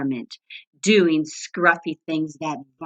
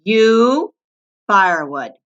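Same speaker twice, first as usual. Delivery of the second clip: curt varmint order; 3 semitones higher, 7 dB louder than usual. A narrator's reading. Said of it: "varmint ordering".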